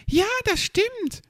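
very high little creature voice; high, eager chiming-in; Hoppel. A narrator's reading. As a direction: pipe up in agreement, pitched far above normal. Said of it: "meinte nun auch Hoppel".

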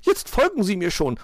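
high, loud impatient magpie; gruff command in a high bird voice; brusque interruption. A narrator's reading. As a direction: cut in curtly and bossily. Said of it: "unterbrach ihn Frau Elster schroff".

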